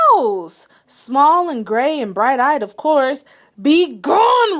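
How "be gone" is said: loud, higher roar. A narrator's reading. Bear roars at the mouse to leave, loud roar. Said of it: "roared the bear".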